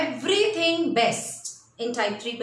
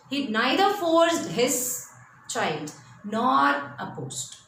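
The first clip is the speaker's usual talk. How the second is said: slow deliberate; much slower than usual. Shown as a demonstration. Slow emphatic storytelling.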